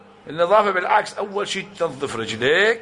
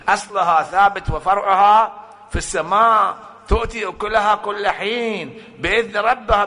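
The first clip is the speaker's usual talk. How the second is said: louder, raised verse, ringing out; proclaimed verse; 5 semitones higher, 3 dB louder than usual. Acted. Preacher proclaiming the verse to the listeners, raised and louder. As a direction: cry the verse out to the hall.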